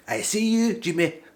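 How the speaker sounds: high, loud mock-Scottish catchphrase; Scottish lingo. Vlogger signs off with new Scottish lingo, mock Scottish accent. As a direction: belt out a put-on Scottish greeting. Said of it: "when I learn some more Scottish".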